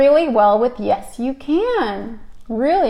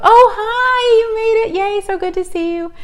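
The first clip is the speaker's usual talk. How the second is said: high, squealing neighbor greeting; chirpy neighbor; 11 semitones higher than usual. Retold or imitated. Mimicking the neighbor's gushing hello from her car. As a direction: gush hello like the excited neighbor.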